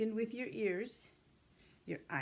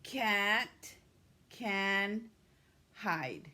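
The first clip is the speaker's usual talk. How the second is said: each word spaced out, "The" louder; slow word by word; 4 dB louder, much slower than usual. Shown as a demonstration. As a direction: dictate one word at a time. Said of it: "Now you say it with Missus Gonzalez".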